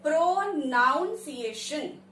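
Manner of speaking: pro-NOUN-see-ay-shun, drawn out in parts; as spelled. Shown as a demonstration. Teacher reading the spelling literally, mispronounced as spelled. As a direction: say "pronunciation" slowly with "noun" in the middle. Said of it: "it will be sounding as".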